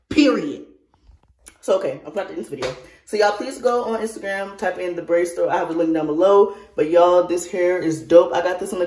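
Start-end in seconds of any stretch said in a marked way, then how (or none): none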